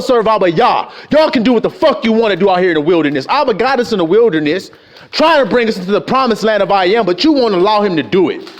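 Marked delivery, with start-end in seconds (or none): none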